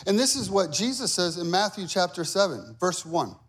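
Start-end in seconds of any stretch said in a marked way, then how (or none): none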